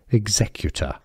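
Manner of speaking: "Executor" with the stress on "ZEC"; stress on second syllable. Shown as a demonstration. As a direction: say "ig-ZEC-yu-tuh" for "Executor", British, no R. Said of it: "The stress is on the Z syllable".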